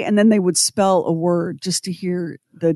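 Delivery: normal talking voice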